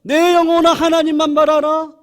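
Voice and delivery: declared loudly to his own soul; proclaiming to the soul. Shown as a demonstration. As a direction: command your soul with full voice. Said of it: "격려하고 선포하고".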